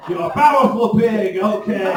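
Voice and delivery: a laughing, hammed-up rich fan; mock impressed guy. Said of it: "he was like".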